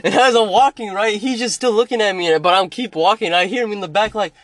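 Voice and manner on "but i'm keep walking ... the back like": normal talking voice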